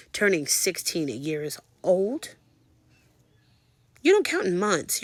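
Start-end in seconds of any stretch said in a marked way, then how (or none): 4.0-5.0 high, loud insistence; emphatic protest. Real, not acted. Mother of a teen on pregnancy maths, emphatic protest. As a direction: push it out as an indignant point.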